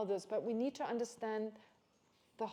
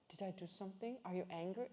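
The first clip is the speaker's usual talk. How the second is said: softer, hesitant imitation of mom; timid quiet mom; 2 semitones lower, 7 dB quieter than usual. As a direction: ask in a small timid voice as the anxious mom. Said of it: "she comes into my room".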